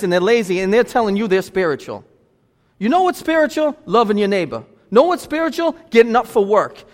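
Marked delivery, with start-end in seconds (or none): 2.8-3.8 pitched up high, calling out the question; rising question. Real, not acted. Preacher's repeated rising question. 4.9-5.7 pitched up high, calling out the question; rising question. Real, not acted. Preacher's repeated rising question.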